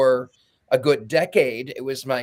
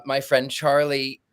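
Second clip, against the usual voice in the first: ordinary delivery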